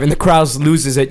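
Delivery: ordinary delivery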